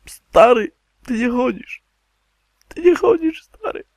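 high, loud pleading; distressed disbelief. Pretend. Gamer to companion who cannot walk, distressed and high. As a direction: cry out in dismay to a friend.

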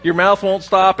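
usual speaking voice

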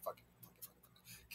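under-breath whisper; whispered swearing. Real, not acted. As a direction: whisper it to yourself. Frustrated at name mix-up.

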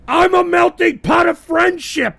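shouted, high, mock-proud declaration; loud boast. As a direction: bellow it like a proud bragger. Host ranting boastfully on air.

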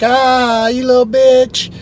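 high, loud jeer; retold taunting yell. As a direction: re-enact yelling insults at a passing guy. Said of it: "I was yelling at some guy".

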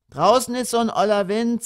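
put-on high little-boy complaint; son's voice. A narrator's reading. Son balking at playing outside, childish complaint. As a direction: pitch it well up, in Berlin dialect.

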